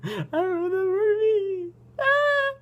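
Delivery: high laughing squeal. Real, not acted. Laughing at unknown word.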